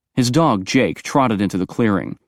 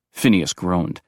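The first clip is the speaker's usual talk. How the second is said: usual speaking voice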